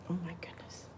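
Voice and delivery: barely voiced, whispered reaction; soft hushed murmur; hushed aside. Real, not acted. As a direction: whisper it under your breath. Aside at Goldilocks's fright.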